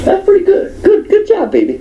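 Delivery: high squeaky fussy woman; high mock praise. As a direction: coo it high like the picky customer. Imitating the customer praising.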